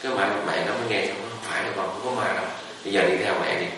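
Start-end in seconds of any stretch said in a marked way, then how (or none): 1.3-3.7 soft, gentle mother's voice, much softer; calm the scared child softly; mother reassuring. Retold or imitated. Mother comforts frightened child. (mẹ dỗ con, giọng nhỏ nhẹ trấn an)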